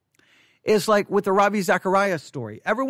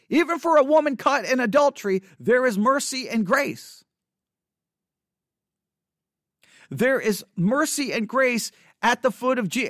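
impassioned preaching; 6 semitones higher than usual. Acted. Preacher urging the church to offer grace, impassioned. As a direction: proclaim grace with rising fervor.

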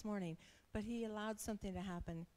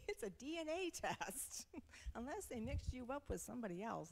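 laughing in disbelief; 6 semitones higher than usual. Retold or imitated. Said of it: "and I'm like".

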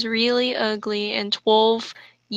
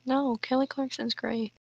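whispered aside; 10 dB quieter, faster than usual. Retold or imitated. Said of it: "and I'm like".

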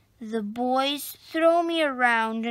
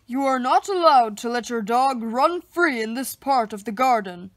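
stern park keeper; 3 semitones lower, 4 dB louder, faster than usual. A narrator's reading. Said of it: "he says".

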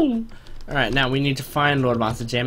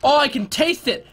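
ordinary delivery

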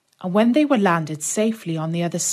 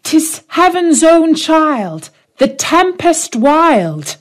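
higher, louder, slow sing-song; children answering the hidden voice, high and slow; 9 semitones higher, 5 dB louder, slower than usual. Acted. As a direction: chant it like innocent children. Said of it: "The children answered".

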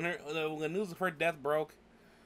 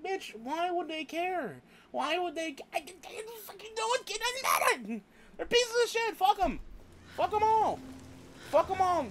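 real exasperated outburst, pitched way up; angry rant; 13 semitones higher than usual. Real, not acted. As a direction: let the scorn boil over into yelling. Ranting about royal family mourners.

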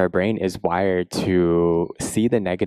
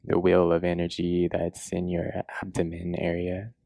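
guided meditation; 4 dB quieter than usual. Acted. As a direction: guide them gently into a body scan, unhurried.

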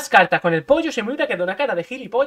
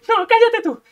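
high and fast boast; bragging inner voice; 11 semitones higher, 4 dB louder than usual. Acted. Inner voice brags to José about the combo. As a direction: crow about being right.

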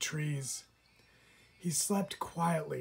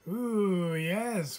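eager; 5 semitones higher, 5 dB louder than usual. A narrator's reading. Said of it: "flapped the dragonfly".

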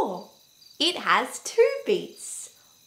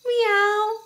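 cat meow; 9 semitones higher, 5 dB louder than usual. Pretend. Cat found in I Spy.

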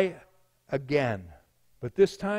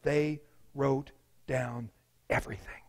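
low voice sinking to a whisper; hushed revelation; 6 semitones lower, 7 dB quieter than usual. Real, not acted. Preacher tells of the sinner's dawning realization, hushed. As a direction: let it sink into a whisper. Dramatic moment of dread in story.

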